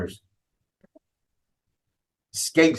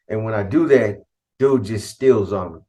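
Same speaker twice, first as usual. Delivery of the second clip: normal talking voice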